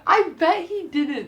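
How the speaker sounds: squeaky high, slow, through suppressed laughter; trying not to laugh at a friend; holding back laughter. Real, not acted.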